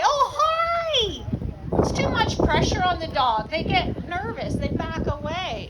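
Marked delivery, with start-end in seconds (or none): 0.0-1.2 high, gushing hello to a dog; overexcited greeting. Shown as a demonstration. Trainer showing a too-eager greeting to a nervous dog, high and gushing. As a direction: squeal a big hello at the dog. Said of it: "I don't go into the dog and say".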